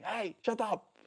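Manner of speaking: high-pitched yell at the dogs, held back in volume; high, half-hearted scolding yell; mock shout. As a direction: imitate snapping at barking dogs without real force. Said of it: "shout at them like".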